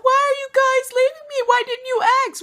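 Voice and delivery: hammed-up teary drunk complaint; drunk crying whine. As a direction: imitate a drunk friend wailing at being left behind. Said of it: "crying, like".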